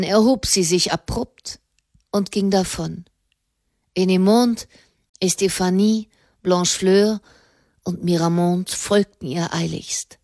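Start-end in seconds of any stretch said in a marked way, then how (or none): none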